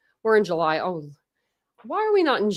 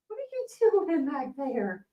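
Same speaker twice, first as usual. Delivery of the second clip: high, softer pet-talk voice; talking to the dog; 8 semitones higher, 5 dB quieter than usual. Real, not acted. Host to her dog behind her, high pet voice. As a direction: coo at the dog behind you.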